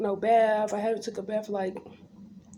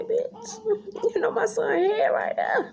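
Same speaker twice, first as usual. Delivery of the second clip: high squeaky retort; 13 semitones higher, slower than usual. Retold or imitated. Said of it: "I like to say".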